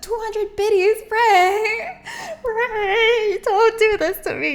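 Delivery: overwhelmed and happy, voice breaking; emotional. Real, not acted. Thanking Ray for biddies, laughing and tearful. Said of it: "You're making me emotional".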